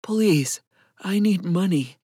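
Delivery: lightly voiced ragged vagrant. A narrator's reading. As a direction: plead for money in a mildly ragged voice. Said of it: "His voice was as ragged as his appearance".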